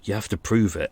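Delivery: ordinary delivery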